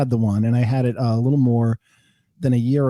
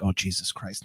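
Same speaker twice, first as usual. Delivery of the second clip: low, soft inward groan of dread; quiet dismay; 9 semitones lower, 8 dB quieter than usual. Retold or imitated. Patient recognized before his colonoscopy, low muttered dismay. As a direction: mutter it under your breath.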